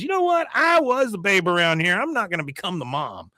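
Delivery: high-pitched huffy retort; playfully pissed-off woman; mock indignation. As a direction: act out her storming off, starting high and easing down. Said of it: "she's pissed and she leaves".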